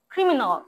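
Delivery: "ক্রিমিনাল" said as "khrimi-nawl"; aspirated k, final awl. Shown as a demonstration. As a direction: puff the first k of "ক্রিমিনাল", end on awl. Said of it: "খ এর মতো উচ্চারণ করো".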